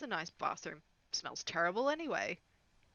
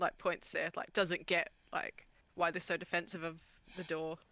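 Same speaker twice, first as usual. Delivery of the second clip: ordinary talk, nothing marked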